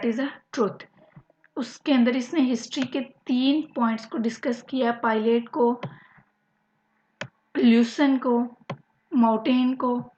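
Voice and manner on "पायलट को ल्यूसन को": ordinary talk, nothing marked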